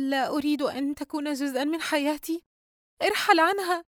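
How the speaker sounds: high, pleading cry to go away; frightened refusal. A narrator's reading. Yusra backs away begging the creature to leave, frightened. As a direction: push it away with fear. Retreating girl refuses demon.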